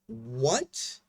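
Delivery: incredulous question with a rising swoop; baffled disbelief. Acted. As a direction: let it rise in sheer bafflement. Baffled at the referee's call.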